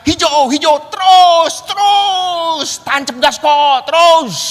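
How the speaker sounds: high, loud shouts to speed on. Retold or imitated. Anton on the back seat yelling at the rider, loud and high. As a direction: urge the rider on hard.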